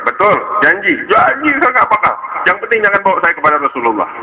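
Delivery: high, stretched promise, then a hurried plea; pleading child. Acted. Caught boy promises Abu Hurairah he will stop, pleading. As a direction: swear it like a desperate little boy.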